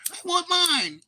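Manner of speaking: put-on high, squealed toddler whine; mock a baby demanding its toy; whiny baby. Repeating the little babies bit, high whine. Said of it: "They're all a bunch of little babies".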